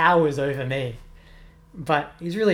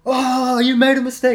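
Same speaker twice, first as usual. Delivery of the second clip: high, loud sing-song jeer at a classmate; schoolboy taunt, high and loud; mocking taunt; 9 semitones higher, 11 dB louder than usual. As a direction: imitate a kid rubbing it in. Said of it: "who would be like".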